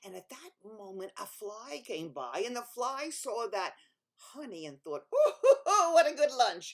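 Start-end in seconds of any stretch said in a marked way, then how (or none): 5.1-6.7 loud, high cackling delight; a greedy little fly laughing at lunch; gleeful fly. Acted.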